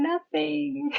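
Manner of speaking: high, comic tearful whine; mock bawling. Answering family who asked what happened, mock tearful. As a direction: whine it high, imitating yourself crying. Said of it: "I'd be sitting there bawling and crying".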